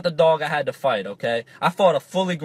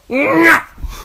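high, strained effort grunt; throwing grunt; 12 semitones higher than usual. Retold or imitated. Nine-year-old throwing hard at dad, exaggerated grunt. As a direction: imitate a kid grunting as he hurls a ball. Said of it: "That was me, the grunt and everything".

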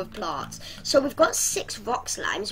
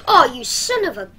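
exasperated outburst; 4 semitones higher, 10 dB louder than usual. Real, not acted. Reacting to game loss.

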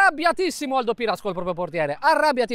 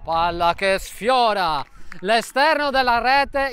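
normal talking voice

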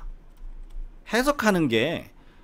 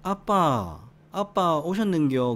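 slow, high-pitched Gyeongsang lilt; drawn-out Gyeongsang greeting; Gyeongsang dialect; 5 semitones higher, much slower than usual. Shown as a demonstration. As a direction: ask it with Gyeongsang intonation. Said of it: "경상도에서는".

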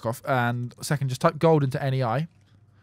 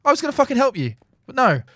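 much higher yelled outburst; frustrated shout; 8 semitones higher, 4 dB louder than usual. Real, not acted. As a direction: blow up at being cut off. Outburst at being interrupted.

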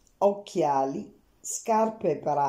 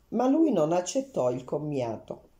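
usual speaking voice

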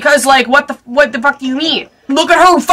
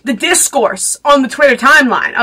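usual speaking voice

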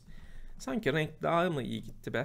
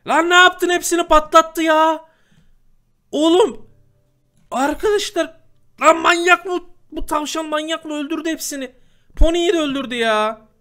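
screams high and loud in disbelief; furious outrage; 12 semitones higher, 12 dB louder than usual. Real, not acted. Gamer shouting as a rabbit kills his pony, outraged yelling. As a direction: yell it out, outraged at the loss.

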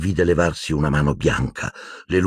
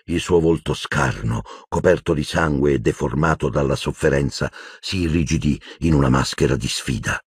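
ordinary delivery

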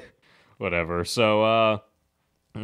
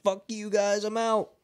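high-pitched, gleeful imitation; gleeful mock taunt; 11 semitones higher than usual. Retelling Annie ditching Stretch, high mock-laughing taunt. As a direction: mimic someone laughing off a getaway. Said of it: "she's, like, laughing about it".

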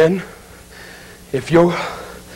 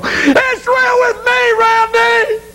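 high, slow, drawn-out wail; tearful shriek of agony; agonized screaming; much slower than usual. Acted. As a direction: scream it out in burning torment. Damned soul screams from hell.

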